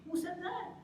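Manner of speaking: high, incredulous question; incredulous challenge. Real, not acted. Challenges the friends' made-up rule.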